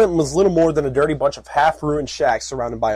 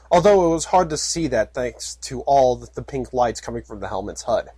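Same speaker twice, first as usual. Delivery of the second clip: ordinary talk, nothing marked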